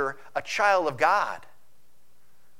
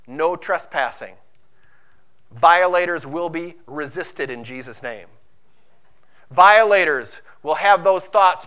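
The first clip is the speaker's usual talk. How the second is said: forceful preaching; 5 dB louder than usual. Acted. Preaching builds again.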